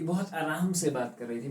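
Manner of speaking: normal talking voice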